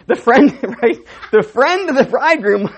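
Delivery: laughing through the words; real laughter breaking in while telling it. Real, not acted. Preacher cracking up telling the wedding custom, laughing.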